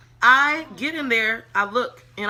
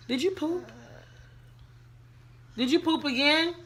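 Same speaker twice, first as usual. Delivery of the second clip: higher-pitched parent's question; talking to a little one; 6 semitones higher, 4 dB quieter than usual. Real, not acted. Breaks off to ask small child.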